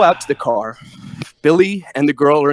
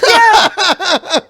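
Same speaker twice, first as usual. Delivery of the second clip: a high laughing yeah; 4 dB louder than usual. Real, not acted. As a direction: burst out the yeah through a laugh. Eager answer, laughing.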